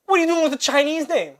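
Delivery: high-pitched, loud disbelief; astonished mimicry. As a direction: imitate someone thrilled and baffled at once. Retold driver amazed at the name.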